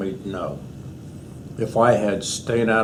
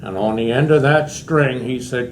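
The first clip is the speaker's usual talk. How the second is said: normal talking voice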